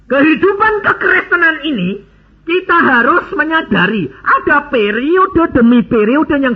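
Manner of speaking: high, ringing sermon delivery; emphatic preaching. Acted. Preacher exhorting the congregation about maturity, emphatic preaching. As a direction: drive each phrase home to the congregation.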